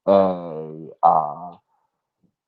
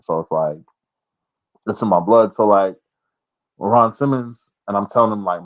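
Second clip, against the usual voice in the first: normal talking voice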